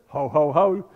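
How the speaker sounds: smug ho ho ho chuckle; a hammed-up smug laugh; mock chuckle. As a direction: chuckle like you've already won. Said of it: "they're kind of chuckling".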